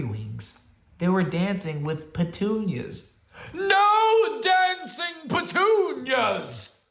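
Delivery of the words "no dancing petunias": very slow, high, loud roar. Acted. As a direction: bellow it out in outrage. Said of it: "Bear roared".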